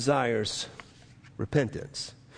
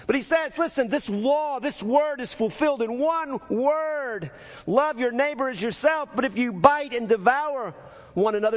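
high, urgent sermon voice; impassioned preaching; 9 semitones higher than usual. Real, not acted. Preacher voicing God questioning Cain's anger, urgent preaching. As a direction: press the warning on them, pitch held high.

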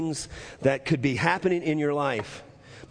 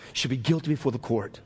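quicker restatement; 2 semitones lower, faster than usual. Real, not acted. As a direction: run the verdict together without pauses. Same line repeated more quickly.

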